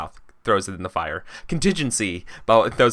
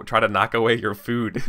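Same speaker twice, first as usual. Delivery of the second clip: ordinary delivery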